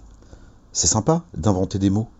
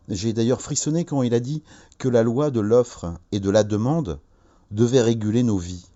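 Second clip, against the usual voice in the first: ordinary delivery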